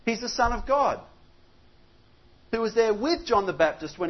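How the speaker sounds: rising preacher's fervour on Son of God; building sermon emphasis. Acted.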